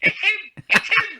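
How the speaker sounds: very high squeaky mock scream from the corner; screaming in top voice. Boxer imitates his corner man screaming, high-pitched mock scream. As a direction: imitate a cornerman shrieking excitedly at falsetto pitch. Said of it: "was screaming in his top, in his top voice".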